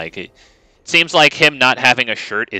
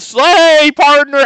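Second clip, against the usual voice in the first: high, loud camp cowboy; 7 dB louder, much slower than usual. Acted. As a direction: flamboyant high cowboy twang. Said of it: "Cowboy Oko be like".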